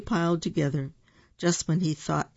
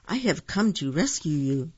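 usual speaking voice

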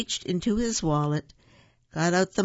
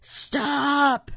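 high, loud, drawn-out shout; all-out yell; 11 semitones higher, 5 dB louder than usual. A narrator's reading. Taya yelling as a lady lifts her, all-out yell. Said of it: "Taya yelled as loud as she could".

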